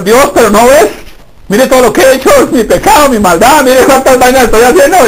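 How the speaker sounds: laughing protest. Pretend. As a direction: confess your mess half laughing. Sinner protesting unworthiness to God.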